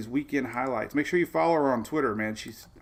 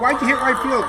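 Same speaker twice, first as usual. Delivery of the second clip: loud, higher challenging question to his daughter; retold shout at daughter; 12 semitones higher, 9 dB louder than usual. Dad calling out to his daughter after the game, loud and raised. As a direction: call it out like a dad yelling from the stands. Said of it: "I said".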